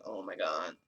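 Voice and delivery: very low, hushed dismay; low, soft acted disbelief; stunned murmur. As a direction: say it under your breath, sinking low. Alone on couch, stunned afterwards.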